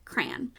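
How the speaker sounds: crayon said softly as "cran"; one-syllable crayon. Shown as a demonstration. Teacher closing on how she says it, one-syllable crayon. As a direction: drop crayon to one quiet syllable, like man. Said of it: "That's how I say it".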